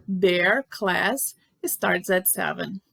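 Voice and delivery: slow, word-by-word example; slow modelling. Shown as a demonstration. Teacher repeats the example after translating it, slow modelling. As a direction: space the words out for learners.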